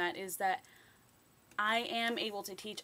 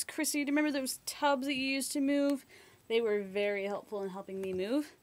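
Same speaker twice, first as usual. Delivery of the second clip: usual speaking voice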